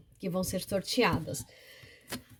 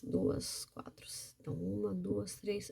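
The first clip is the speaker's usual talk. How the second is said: murmured counting, much softer; counting under her breath; 13 dB quieter than usual. Real, not acted. Teacher counts card squares to herself, murmured. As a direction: count the squares quietly to yourself.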